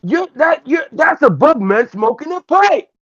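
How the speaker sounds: very high, slow puppet voice, amazed; astonished puppet character. Acted. Puppet astonished at a bug man smoking, high-pitched amazement. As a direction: squeal in drawn-out wonder as a little puppet.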